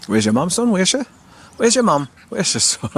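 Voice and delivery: high sing-song pet talk; talking to the dog. Real, not acted. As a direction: owner teasing the dog about its mum.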